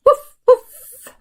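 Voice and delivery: high yappy barks; teacher barks like the dog. Acted. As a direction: bark like a puppy. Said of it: "et le chien".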